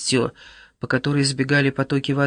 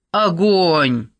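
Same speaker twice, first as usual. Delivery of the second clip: drawn-out shouted command, pitch raised; Waxman; 4 semitones higher, 4 dB louder than usual. A narrator's reading. Waxman orders his men to shoot at the monster, shouting. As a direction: shout the order, higher but not at full volume. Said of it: "закричал Ваксман".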